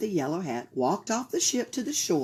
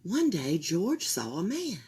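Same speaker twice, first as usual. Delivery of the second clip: plain reading voice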